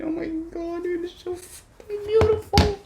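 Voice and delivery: overjoyed high-pitched gushing; excited squeal. Real, not acted. Gushing over new laptop.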